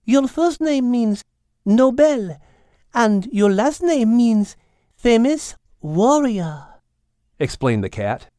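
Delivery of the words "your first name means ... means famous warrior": put-on high, slow French-accented cat explaining; Mademoiselle. Cat explaining Al's name to him, slow high French accent.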